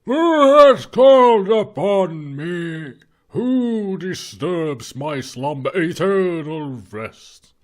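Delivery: slow, loud, eerie ghost voice; dusty mummy rasp. Acted. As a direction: drag out each word from the grave. Ghost answers the summons.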